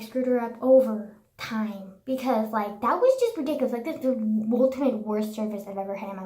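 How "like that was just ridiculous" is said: ordinary talk, nothing marked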